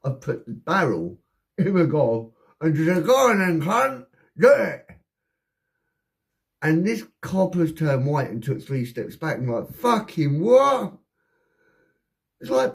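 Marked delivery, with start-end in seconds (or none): 3.0-4.9 loud, high goading yell; acted-out reckless challenge; defiant dare. Daring an armed officer to shoot. 9.7-11.0 loud, high, stretched-out question; mock stunned exclamation; shocked disbelief. Shocked copper reacting to the dare.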